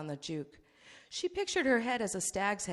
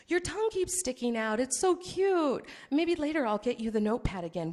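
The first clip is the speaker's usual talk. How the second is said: higher, coo it like talking to a pet; cooing condescension; 5 semitones higher, 5 dB louder than usual. Acted. Seth coos at mute Artel.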